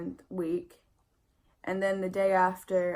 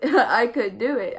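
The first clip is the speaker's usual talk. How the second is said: laughing; 4 semitones higher, 11 dB louder than usual. Real, not acted. Laughing about eating so much.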